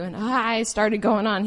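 normal talking voice

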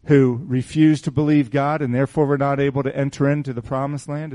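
ordinary delivery

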